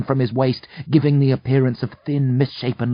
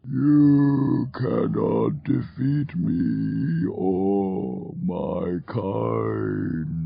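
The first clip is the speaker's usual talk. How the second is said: mournful demon; much slower than usual. A narrator's reading. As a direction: lament mortal ignorance slowly. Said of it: "the demon says".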